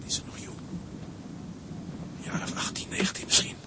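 hushed whisper over the sleeper. Acted. Father whispers beside the unconscious girl, hushed whisper. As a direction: whisper so the girl won't wake.

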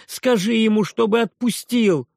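high, loud begging cry; desperate boy's plea. A narrator's reading. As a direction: beg the aunt at the top of his voice. Boy pleads loudly. (отчаянная мольба мальчика, громко)

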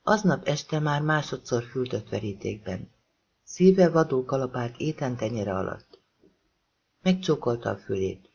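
ordinary delivery, nothing marked

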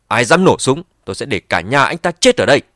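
loud, hurried angry threat; angry shout. Acted. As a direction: yell the threat in anger. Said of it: "vội vàng hét lên một tiếng tức giận".

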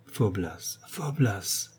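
put-on the marquise gently reproaches her young lover; gentle reproach. Soft tender reproach. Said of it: "m'adressant un doux reproche".

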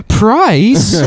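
high, startled "Price?"; shocked question. Retold or imitated. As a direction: ask it in mock amazement. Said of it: "and he goes".

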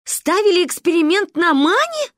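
high, loud incredulous question; appalled. A narrator's reading. Mother learning of the experiment, appalled. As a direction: demand it in rising disbelief. Shocked mother demands the truth.

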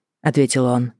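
ordinary delivery, nothing marked

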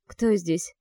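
usual speaking voice

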